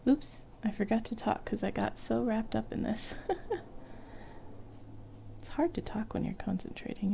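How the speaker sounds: soft ASMR whisper. Real, not acted. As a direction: whisper it gently. Soft whispering while drawing.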